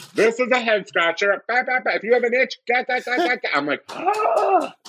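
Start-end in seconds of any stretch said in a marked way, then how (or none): 0.1-3.5 put-on very high small-child voice; little girl. Niece of four or five selling a head scratcher, child voice. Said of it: "my niece went up, she's like". 3.9-4.8 very high drawn-out gasp; amazed gasp. Retold or imitated. Aunt hearing the niece's clever sales pitch, mock amazed gasp.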